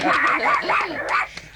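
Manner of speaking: loud high breathy laughing; giddy group laughter; happy laughter. Acted. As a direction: laugh with joy. Laughter after cheering.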